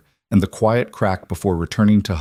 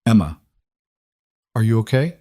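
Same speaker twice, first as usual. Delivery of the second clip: put-on small, shaky girl's voice; trembling child voice; 2 semitones higher, faster than usual. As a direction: ask it timidly, voice trembling. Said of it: "Lily's trembling voice".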